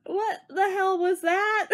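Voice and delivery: incredulous laugh, slow; baffled laughing question; laughing disbelief. Retold or imitated. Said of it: "everybody's just like".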